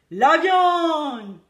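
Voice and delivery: loud rowdy yell; crew yelling. A narrator's reading. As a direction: bellow it as a hungry crew. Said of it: "tout le monde a hurlé".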